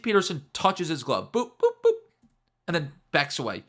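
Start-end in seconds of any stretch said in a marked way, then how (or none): 1.3-1.9 very high, loud little boops; playful boops. Retold or imitated. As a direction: imitate light taps with squeaky boops. Imitates tapping on the glove.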